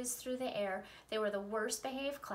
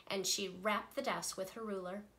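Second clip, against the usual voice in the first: plain reading voice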